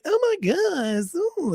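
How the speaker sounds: put-on high, slow swooning Valley Girl; mock Valley Girl squeal. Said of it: "California Valley Girl gays".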